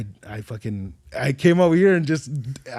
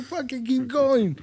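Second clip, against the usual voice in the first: hyped; 10 semitones higher, slower than usual. Retold or imitated. Crowd urging him on.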